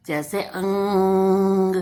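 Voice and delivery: अंग drawn out slowly through the nose; teacher stretches अंग with a long nasal ng; nasal अंग demo. Shown as a demonstration. Teacher to children, demonstrating nasal sound, slowly. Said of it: "इसका उच्चारण नाक का प्रयोग करते हुए".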